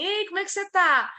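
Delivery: mock chummy high-voiced greeting; over-friendly greeting. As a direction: pitch it way up like an overly sweet greeting. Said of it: "vocês conversem com todo mundo assim".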